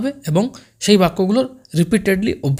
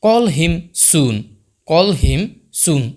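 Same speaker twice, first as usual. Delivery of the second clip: slow teaching demo; much slower than usual. Shown as a demonstration. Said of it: "next".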